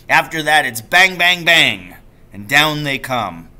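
storyteller father hamming up the shoot; gleeful bang-bang. Acted. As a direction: punch out the gunshots with glee. Father relishing the shooting scene.

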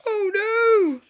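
high, loud storybook wail; alarmed cry. Acted. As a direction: wail it out in mock panic. Acted alarm in children's story.

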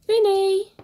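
loud call for the dog; firm loud call. Real, not acted. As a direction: shout the dog's name. Calling dog by name.

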